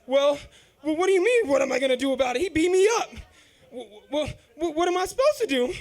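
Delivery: put-on defensive whining kid; flustered protest. Beaten teen pleading with mom, flustered and loud.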